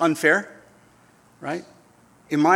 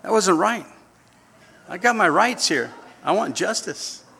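mock sulk; 5 semitones higher than usual. Pouting over kindergarten unfairness.

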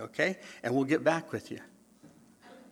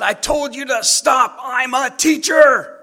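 high, loud, slow shout; teacher chasing kids down the hall; 11 semitones higher, 11 dB louder, slower than usual. Retold or imitated. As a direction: yell your authority at them. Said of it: "I said".

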